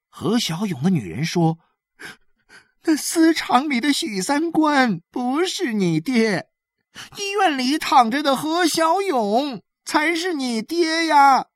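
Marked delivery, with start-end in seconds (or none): 2.8-11.5 high, wailing woman's plea; tearful insistence. A narrator's reading. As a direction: insist through tears in a high voice.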